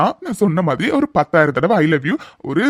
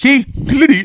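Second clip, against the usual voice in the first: calling her back; 5 semitones higher than usual. Acted. Groom calls after the leaving bride, commanding. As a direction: order her to stop.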